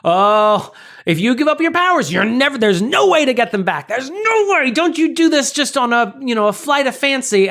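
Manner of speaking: high, dramatic mock-movie pleading; imitated parental warning. Movie parents warning their son about losing his powers, mock-dramatic. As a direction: warn grandly, pitched well above your own voice.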